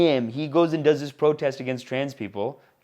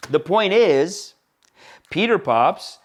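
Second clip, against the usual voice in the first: ordinary delivery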